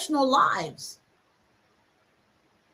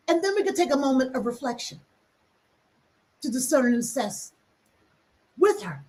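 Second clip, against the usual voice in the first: usual speaking voice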